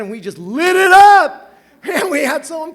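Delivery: exultant roar of joy; triumphant. Real, not acted. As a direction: shout it out in victory. Triumphant dance memory.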